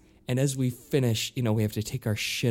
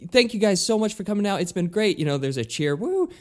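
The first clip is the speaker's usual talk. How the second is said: high, fast stage hype; hyping the crowd; 7 semitones higher than usual. Retold or imitated. Frontman to thousands of fans, high fast hype.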